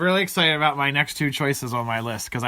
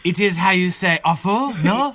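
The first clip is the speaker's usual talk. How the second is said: hint of a playful French lilt, higher and louder; mock French accent; 6 semitones higher, 6 dB louder than usual. Joking back to cohost, light mock French accent. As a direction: tease it like a Frenchman. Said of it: "it's awful".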